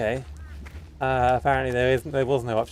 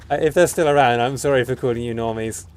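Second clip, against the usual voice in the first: loud; 8 dB louder than usual. Real, not acted. Loud apology to viewers.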